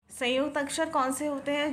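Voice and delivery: ordinary delivery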